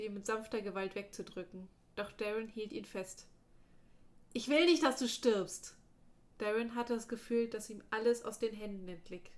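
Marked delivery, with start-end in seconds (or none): 4.3-5.8 high, loud desperate outburst; desperate cry. Acted. Darren begging his dying friend not to die, crying out.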